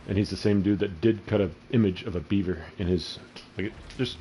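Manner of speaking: normal talking voice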